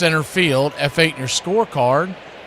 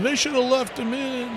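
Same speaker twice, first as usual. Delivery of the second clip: mock shock jock; 7 semitones higher, 9 dB quieter than usual. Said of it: "shock jocks out there saying".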